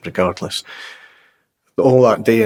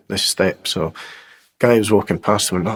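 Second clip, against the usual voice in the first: ordinary talk, nothing marked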